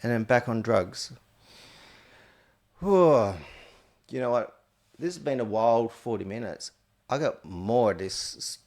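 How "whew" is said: loud relieved whew. Real, not acted. Relief after long story.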